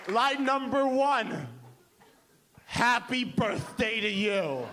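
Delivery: slow, drawn-out proud announcement; grand pitch. Retold or imitated. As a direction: present it slowly like a masterpiece. Arrogant songwriter pitches her lines.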